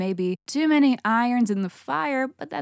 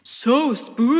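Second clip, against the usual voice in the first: spooky; 4 semitones higher, 6 dB louder, slower than usual. Pretend. Repeats spooky, drawn out.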